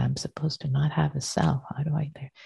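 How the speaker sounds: hushed, confused student voice; whispered doubt. Retold or imitated. Voicing a confused practitioner.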